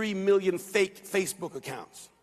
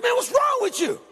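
very high, raised incredulous challenge; retold rebuke; 12 semitones higher, 4 dB louder than usual. Preacher retells challenging an angry young man, high incredulous rebuke. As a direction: throw it back in loud disbelief, retold.

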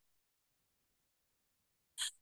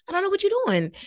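mock Juliet; 13 semitones higher, faster than usual. Voicing Juliet's attitude.

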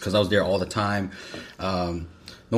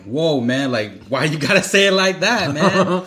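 louder and higher, laughing through it; 8 semitones higher, 7 dB louder, slower than usual. Retold or imitated. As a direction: laugh while protesting. Retold own reaction, laughing.

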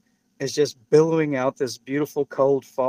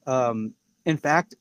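ordinary talk, nothing marked

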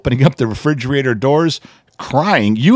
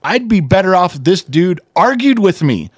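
higher, exasperated daughter's rant; imitating exasperated daughter; 7 semitones higher, 4 dB louder than usual. Dad retells his daughter venting about her boyfriend, higher and exasperated. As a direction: pitch it up and let her frustration spill.

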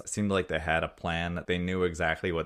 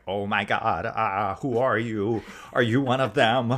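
scared American act; 4 semitones higher, 4 dB louder, slower than usual. Acted. Villain pretending to be a hostage meeting the hero, scared. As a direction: pitch climbing in feigned panic. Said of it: "scared American accent of being like".

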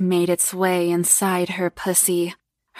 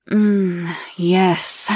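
breathy voice. Acted. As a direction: breathe the words out. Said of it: "he said in a breathy voice".

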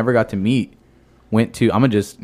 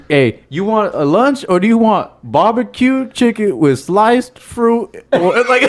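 high, slow, laughing mom imitation; mom's voice; 9 semitones higher, slower than usual. Son mimicking his mom asking about lunch, high and slow with laughter.

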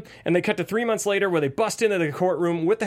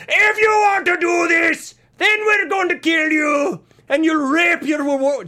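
hammed-up monologue shout, high and slow; imitating a nonstop screamed speech; mock screaming; 11 semitones higher, 6 dB louder, slower than usual. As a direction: parody the actor yelling it all. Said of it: "screaming the exact same way the whole time".

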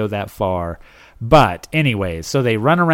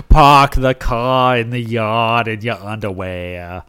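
put-on Boston accent; 2 semitones lower than usual. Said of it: "It's hard to stick with one accent".

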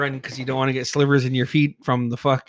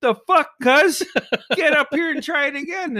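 high, raised-voice order; mock scolding; 12 semitones higher, 3 dB louder than usual. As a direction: imitate an exasperated cousin barking from above. Cousin's retold scolding order.

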